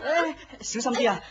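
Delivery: sudden high, loud cry of alarm; startled shout of caution; alarmed warning. Acted. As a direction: call out as she trips. She stumbles, he calls out.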